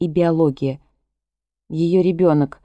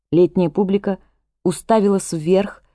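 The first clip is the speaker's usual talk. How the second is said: ordinary delivery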